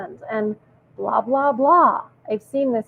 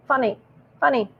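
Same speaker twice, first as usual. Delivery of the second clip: ordinary delivery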